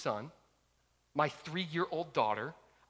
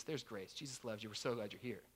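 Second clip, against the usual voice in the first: gentle reassurance; 6 semitones lower, 13 dB quieter than usual. Real, not acted. Pastoral comfort to the congregation.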